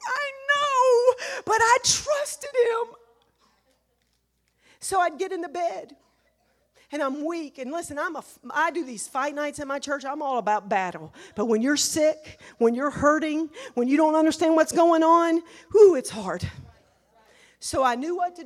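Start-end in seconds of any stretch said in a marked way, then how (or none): 0.1-3.0 high crying shout, louder and slowing. Retold or imitated. To her husband after his Bible talk, a crying shout. 11.3-16.5 preaching build. Acted.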